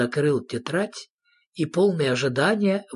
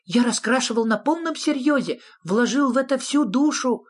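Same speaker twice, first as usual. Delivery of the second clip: high, earnest little creature; anxious high-pitched justification; nervous; 11 semitones higher than usual. A narrator's reading. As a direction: plead nervously for approval in a high voice. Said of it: "нервозно спросил зверок-шнурок".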